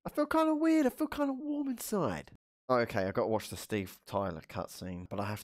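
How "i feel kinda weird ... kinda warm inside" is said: high silly voice. Pretend.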